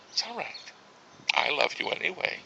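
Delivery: hushed, soft imitation of a wife; imitating wife's forgiving look. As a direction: softly mimic her long-suffering reassurance. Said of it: "she'll give me the look".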